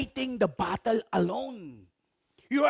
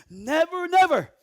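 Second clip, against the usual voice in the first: "never" repeated, rising higher and louder; stress on never; 7 semitones higher, 8 dB louder than usual. Shown as a demonstration. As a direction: hammer "Never, never" harder the second time. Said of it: "will never leave you". (diin sa "never")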